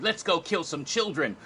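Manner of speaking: rally everyone with excited glee. Retold or imitated. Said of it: "at the end, she's all excited".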